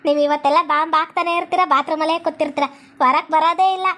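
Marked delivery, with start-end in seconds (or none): none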